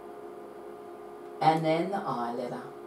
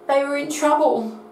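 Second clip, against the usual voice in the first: dramatic alarm; 9 semitones higher, 6 dB louder than usual. A narrator's reading. Storyteller dramatizing ship trouble.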